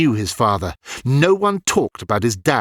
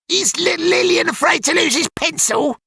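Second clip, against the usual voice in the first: high, taunting schoolboy voice; mocking bully. A narrator's reading. Bully Petey taunting Ilian, high mocking voice. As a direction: sneer it like a cruel kid. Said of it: "Petey mocked".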